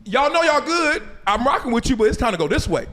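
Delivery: lightly voiced coach, starting high and settling; straight-talking coach. Coach redirecting a last place team, lightly acted straight talk. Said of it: "coach don't come in there talking about".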